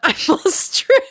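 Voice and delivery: high, loud punchline through laughter; laughing. Retold or imitated. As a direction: deliver the retold reply while cracking up. Cracking up at the punchline.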